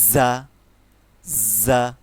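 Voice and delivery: loud জা with a hard z; firm za. Shown as a demonstration. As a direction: press জা firmly. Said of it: "জার রূপটা হচ্ছে শক্ত হবে".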